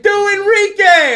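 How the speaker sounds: loud, high, stretched-out heckle; imitated shouting. Host mimicking someone calling for an impression, drawn-out shout. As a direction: holler it like a demanding audience member. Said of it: "someone shouting".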